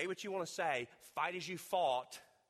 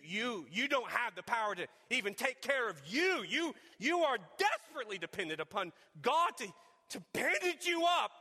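rising, louder preaching that climbs high; full-force preaching; 7 semitones higher, 6 dB louder than usual. Acted. Preacher urging a hurting congregation, building to full force.

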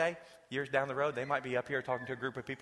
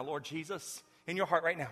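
ordinary talk, nothing marked

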